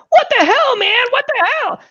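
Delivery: very high shrieked alarm; high-pitched cartoon freak-out; Stewie. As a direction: shriek it high in horrified disbelief. Stewie reacting to Brian throwing up.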